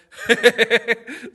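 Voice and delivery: hearty laugh. Real, not acted. Greeting a friend on stream.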